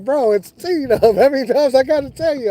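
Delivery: amused buddy correcting a name; friend, teasing. Retold or imitated. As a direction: tease him for forgetting again, with a laugh. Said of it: "He's like".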